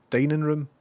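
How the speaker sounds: usual speaking voice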